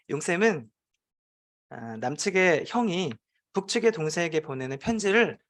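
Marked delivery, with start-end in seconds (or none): none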